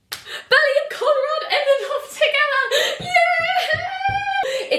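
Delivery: high, loud, slow and squealing with joy; thrilled squeal. Real, not acted. Vlogger shrieking that Belly and Conrad got together. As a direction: shriek out the big news.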